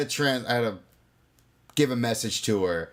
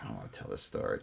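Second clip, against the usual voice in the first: whispering; 12 dB quieter, faster than usual. Real, not acted. Reluctant aside before a story.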